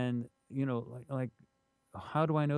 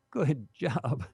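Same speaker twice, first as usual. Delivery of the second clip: gloating laugh; 4 semitones higher than usual. Retold or imitated. Bismarck pleased his plan worked.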